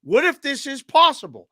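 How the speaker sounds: high, insistent pitch of a wild idea; eager insistence. Retold or imitated. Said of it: "I'm like".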